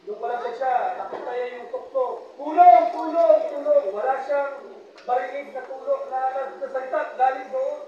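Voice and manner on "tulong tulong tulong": shouted calls for help, fading; calling out from the cliff for help; man dangling from a branch yells for help; cry for help. Retold or imitated. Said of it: "sabi niya gano'n".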